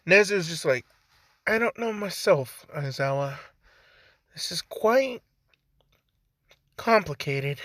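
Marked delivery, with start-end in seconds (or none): 1.4-7.6 high, put-on British accent; polite British character, pitched up; Nezu. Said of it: "I don't know why I made him British".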